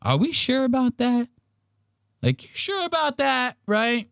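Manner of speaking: high incredulous questioning; squeaky doubtful ask; high skeptical voice. Retold or imitated. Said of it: "I go".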